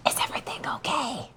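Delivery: hushed whisper from below. Retold or imitated. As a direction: whisper it, hidden and worried. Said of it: "you hear from roughly the knees".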